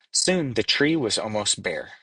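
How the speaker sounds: plain reading voice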